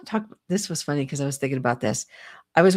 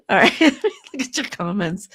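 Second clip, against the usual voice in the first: chuckling through the words; amused laugh at viewers' comments; 4 semitones higher, 4 dB louder than usual. Real, not acted. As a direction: laugh as you read the chat.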